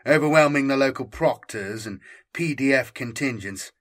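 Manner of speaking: usual speaking voice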